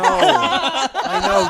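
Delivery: loud high laughing I know. Real, not acted. Laughing reply.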